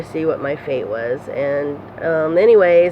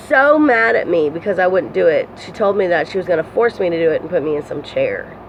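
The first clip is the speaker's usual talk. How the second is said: usual speaking voice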